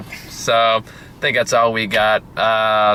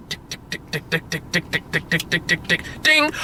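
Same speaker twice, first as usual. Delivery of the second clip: soft, breathy tick-tick of a clock counting down; imitated clock ticking; 3 semitones higher, 5 dB quieter than usual. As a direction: click out a quiet countdown, then a ding. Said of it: "this ticking sound".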